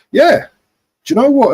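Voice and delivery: sudden high loud burst; excited outburst. Real, not acted. Fan blurting out to viewers, excited. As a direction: blurt it out, fired up.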